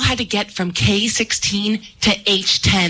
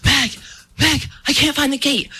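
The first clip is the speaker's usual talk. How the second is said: acted out-of-breath phone answer, higher voice; winded and higher-pitched; breathless; 7 semitones higher than usual. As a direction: gasp between the words, imitating a winded brother. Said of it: "He answers breathlessly".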